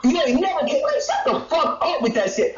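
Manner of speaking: ordinary talk, nothing marked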